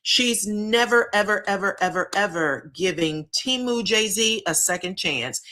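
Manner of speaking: each ever stretched and stressed. Retold or imitated. Retelling her vow, stressing each ever. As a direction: lean on every ever.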